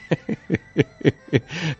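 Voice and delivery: bright rising laugh. Real, not acted. Storyteller laughing at the husband's secret, rising laugh. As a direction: laughs at the funny tale.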